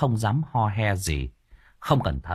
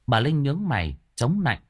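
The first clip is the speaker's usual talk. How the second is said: plain reading voice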